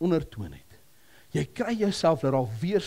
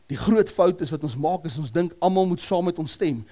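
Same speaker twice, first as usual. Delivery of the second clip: ordinary delivery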